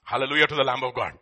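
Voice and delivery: normal talking voice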